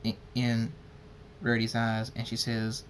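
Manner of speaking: plain reading voice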